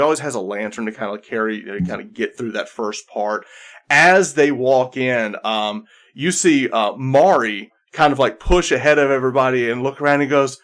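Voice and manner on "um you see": ordinary talk, nothing marked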